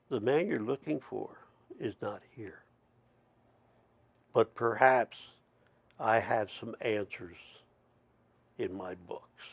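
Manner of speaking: lightly voiced wise elder, murmured; hushed mysterious elder. The author answers the narrator's search in a hushed voice. Gray Elk's cryptic reply.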